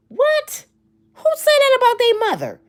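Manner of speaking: who would say that, high and stunned; shocked. Real, not acted. Vlogger reacting to [name]'s mama remark, shocked. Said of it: "shocked outburst".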